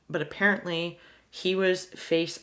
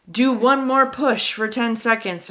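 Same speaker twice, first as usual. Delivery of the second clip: louder, higher push-harder call; nurse's urging call; 5 semitones higher, 7 dB louder than usual. Retold or imitated. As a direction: cheer her on like a labor nurse.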